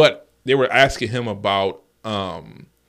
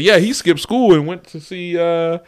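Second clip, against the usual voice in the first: nervous confession; 6 semitones higher, 5 dB louder than usual. Retold or imitated. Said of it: "I said".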